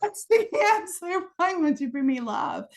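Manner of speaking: playful retelling of desperate pleading; mock anguished plea. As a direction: beg for an answer, hammed up. Acted crying out to God.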